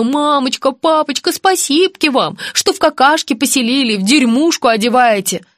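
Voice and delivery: high, sugary fake gratitude; sarcastic baby-talk thanks; mock sweet child voice.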